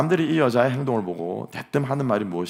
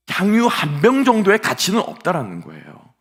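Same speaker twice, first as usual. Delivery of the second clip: emphatic loud; 10 semitones higher, 9 dB louder than usual. Acted.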